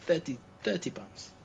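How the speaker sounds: name the price with a salesman's lilt; wallet seller's price. Retold or imitated.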